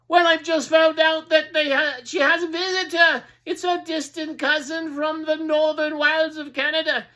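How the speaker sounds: high, loud crier's voice; town crier answering. Acted. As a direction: insist on the news.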